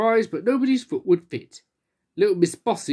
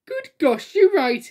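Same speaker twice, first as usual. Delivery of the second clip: much higher surprised outburst; exclaiming; 10 semitones higher, slower than usual. Acted. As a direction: act amazed at the news. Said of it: "exclaimed Little Miss Spotty".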